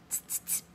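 quiet, separate tongue clicks; monkeys' mocking tsks. A narrator's reading. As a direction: click back like cheeky monkeys. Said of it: "looked at him and said".

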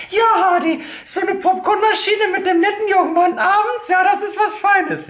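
put-on high, gushing girly voice; female fan voice. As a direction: coo like an admiring female fan. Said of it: "weiblichen Zuschauer werden jetzt sagen".

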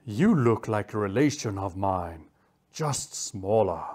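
calm, scary. Acted. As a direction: size them up with unhurried menace. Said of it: "in a calm, scary voice".

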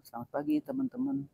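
flat, listless greeting, energy dropped; lesu dan datar. Shown as a demonstration. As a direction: greet them like you can barely be bothered. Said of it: "Nah itu kan kurang ya, jadi datar". (sapaan lesu tanpa semangat)

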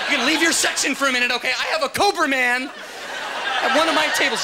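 high, loud, laughing mock panic; rally the staff in a hammed-up fright; panicked waiter. Waiter begging kitchen staff for help, hammed-up panic.

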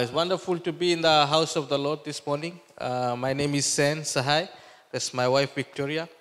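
normal talking voice

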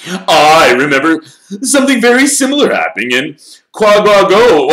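high, loud, self-important swagger; boastful storyteller. A narrator's reading. Lockhart boasting to the room about his past, pompous and loud. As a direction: crow about past exploits, laughing at the end.

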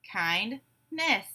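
"Kindness" in two separated beats; syllable by syllable. Shown as a demonstration. Class breaks the word apart together, syllable by syllable. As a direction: say "Kindness" as kind, pause, ness. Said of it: "break it apart into syllables".

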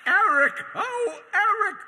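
high, loud call of his name. Acted. Grimsby searching the beach for Eric, high loud calling. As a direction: cry out for him across the beach.

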